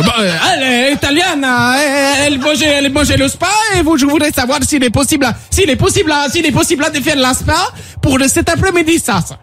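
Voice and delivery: fake Italian accent. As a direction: bluff a clumsy Italian caller. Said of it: "fais l'allemand qui passe ton cousin italien".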